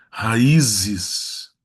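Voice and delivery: Raízes with a loud, stretched Í; stress on Í. Shown as a demonstration. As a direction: lean on the Í in "Raízes". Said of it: "regra do hiato".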